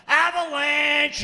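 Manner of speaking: high, slow, loud playful yell "Avalanche!". Retold or imitated. Girlfriend on counter yelling playfully before falling. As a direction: belt it out high and slow like a game call. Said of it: "She used to yell".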